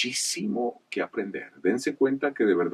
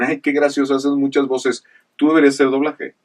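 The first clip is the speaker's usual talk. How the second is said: imitating relatives; 7 semitones higher, 8 dB louder than usual.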